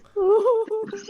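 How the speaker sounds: breaks into a high laugh; high burst of laughter. Real, not acted. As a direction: laugh at your own joke. Teller laughs at own story.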